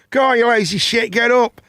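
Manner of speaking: mocking passers-by. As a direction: lightly mimic builders heckling him. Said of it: "people walking past me going".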